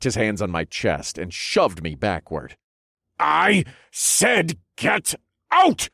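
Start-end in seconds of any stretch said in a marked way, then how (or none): 3.2-5.9 high, slow, shouted order; furious yell. A narrator's reading. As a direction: yell it in his face. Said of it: "the Duke yelled".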